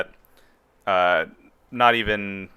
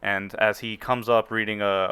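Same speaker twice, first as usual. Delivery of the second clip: ordinary talk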